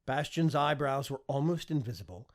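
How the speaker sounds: usual speaking voice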